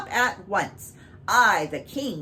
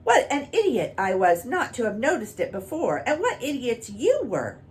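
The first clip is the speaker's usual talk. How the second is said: louder, laughing excited king; 3 semitones higher, 4 dB louder than usual. Acted. King realising the answer, excited and louder. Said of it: "getting very excited".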